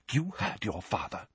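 hushed, soft warning whisper. A narrator's reading. Mother hushing her sons at the bedside, soft whisper. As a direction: keep it down, barely voiced. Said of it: "whispered Missus Weasley".

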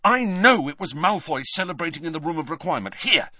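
high, fast insistence; urgent Harry. A narrator's reading. Boy convincing doubtful friends, urgent and fast. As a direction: rush it out with conviction.

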